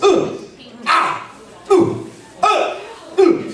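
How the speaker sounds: high, weak fake grunts of being punched; punch grunts lacking impact. Shown as a demonstration. Teacher showing what most people do when punched, unconvincing grunts. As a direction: fake getting hit, with no impact behind it. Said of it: "what most people do is".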